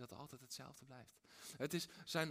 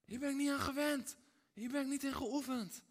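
much higher, loud, strained protest; stuck in armor that doesn't fit; David in heavy armor, flustered; overwhelmed David; 9 semitones higher, 7 dB louder than usual. Retold or imitated. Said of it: "Hij zegt".

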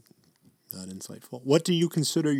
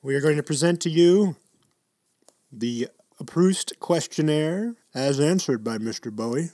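grand announcement; 4 semitones higher, 6 dB louder than usual. Pretend. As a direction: announce it to the hall, higher and louder.

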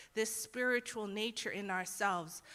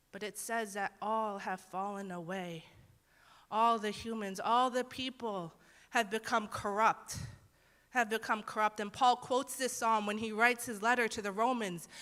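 normal talking voice

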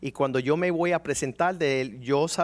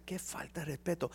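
much softer, under the breath; snide muttering at the praying woman; muttered aside; 12 dB quieter than usual. Retold or imitated. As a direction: mutter it so she can't hear. Inner grumble during prayer.

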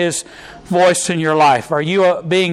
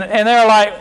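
ordinary talk

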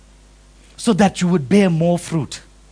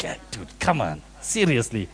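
muttered aside; 8 semitones lower, 8 dB quieter than usual. Retold or imitated. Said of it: "It's like".

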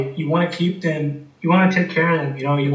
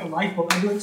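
normal talking voice

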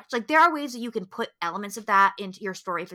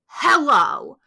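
second hello pushed louder and higher; exasperated, louder hello; louder mock hello; 3 semitones higher, 8 dB louder than usual. Real, not acted. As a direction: call out as if to someone not listening. Mocking the obvious killer reveal.

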